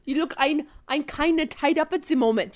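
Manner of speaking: German villain slipping in German words; German accent demo. Shown as a demonstration. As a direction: show German with "ein" thrown in. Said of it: "You can put German words in".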